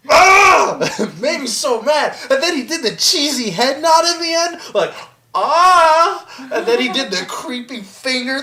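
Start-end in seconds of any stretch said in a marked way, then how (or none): 0.1-0.8 high, loud frustrated cry; exasperated yell. Real, not acted. Fan maddened by the spider detour, exasperated yell. 5.3-6.3 high, loud imitated "ah"; mock cheesy gasp. Mocking the cheesy head nod, hammed-up cry.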